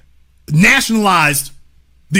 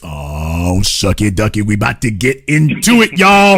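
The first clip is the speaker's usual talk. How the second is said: gleeful hype; 12 semitones lower than usual. Real, not acted. Warning the viewers what is coming, gleeful hype. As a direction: wind up slowly from a low growl. Hyping up what comes next.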